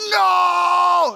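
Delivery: long, loud mock "No!"; horrified team shout. Whole team running from the kiss, hammed-up horrified yell. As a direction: holler it high and drawn out like a recoiling team.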